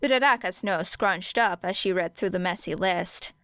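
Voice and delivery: ordinary delivery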